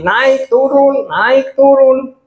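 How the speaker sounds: "naik" swung high, "turun" sliding down, drawn out; up-and-down intonation. Shown as a demonstration. Speaker demonstrates intonation to preachers, slow swooping tune. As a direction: slowly act out the rise and fall on "naik turun". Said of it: "saya nggak datar".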